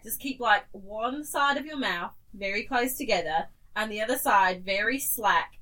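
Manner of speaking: normal talking voice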